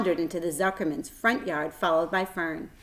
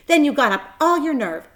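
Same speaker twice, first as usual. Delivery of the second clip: building suspense; 7 semitones higher, 8 dB louder than usual. A narrator's reading.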